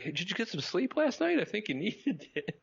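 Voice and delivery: high teasing woman voice; playful high-pitched teasing; bartender voice. Retold or imitated. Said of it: "She's like".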